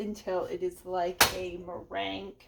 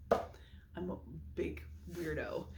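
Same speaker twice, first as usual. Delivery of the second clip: whisper; 2 semitones lower, 7 dB quieter, slower than usual. Real, not acted. Self-mocking whispered aside.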